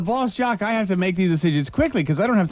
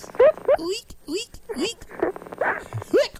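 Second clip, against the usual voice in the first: high squeaky mock squeak; imitating squeaks; 4 dB quieter, much slower than usual. Host to caller, imitating her squeaky laugh. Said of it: "She's squeaking when she laughs".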